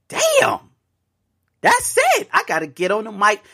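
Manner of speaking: high, excited burst of discovery; excited realization. Retold or imitated. Host realizing he must tell listeners, high excited exclaim. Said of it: "And I say".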